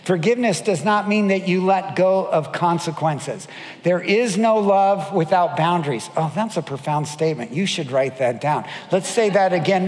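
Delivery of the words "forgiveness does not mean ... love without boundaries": ordinary delivery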